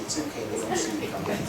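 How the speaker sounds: ordinary delivery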